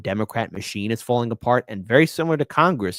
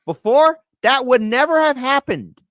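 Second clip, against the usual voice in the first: emphatic; 11 semitones higher, 7 dB louder than usual. Real, not acted. Emphatic disbelief.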